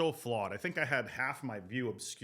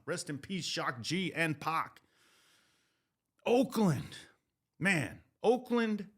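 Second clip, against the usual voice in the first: hype show intro; 5 semitones higher than usual. Acted. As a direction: sell the city like a radio host kicking off a segment. Hypes up the Oakland segment.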